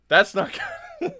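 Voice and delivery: loud, high replayed dismay; high-pitched retold worry; retold alarm. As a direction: blurt it out in mock alarm. Retold reaction to the fire.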